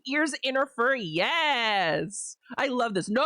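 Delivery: usual speaking voice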